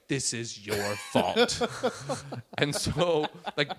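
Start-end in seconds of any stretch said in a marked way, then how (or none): 0.1-1.6 slow, lower imitated blame; accusing. Whole audience to comic on stage, accusing. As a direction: lay blame slowly, as the crowd. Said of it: "everybody turns to me on stage".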